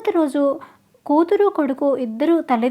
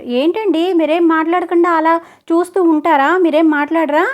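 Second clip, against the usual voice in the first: sharp, louder rebuke; loud scolding; 4 semitones higher, 6 dB louder than usual. A narrator's reading. Wife loudly scolding her husband for staying silent. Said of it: "గట్టిగా కసిరింది కమల".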